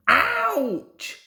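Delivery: high, loud yelp of surprise; cry of pain. A narrator's reading. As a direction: yelp as mud hits you. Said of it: "said Bert".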